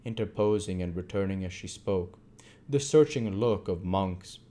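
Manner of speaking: ordinary delivery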